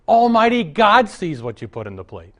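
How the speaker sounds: booming preacher's challenge to the congregation; emphatic preaching. Real, not acted. Preacher thunders at the congregation about giving, loud emphatic. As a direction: drive it home loud to the pews.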